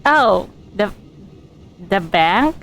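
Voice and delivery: ordinary talk, nothing marked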